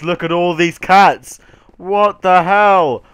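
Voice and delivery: ordinary talk, nothing marked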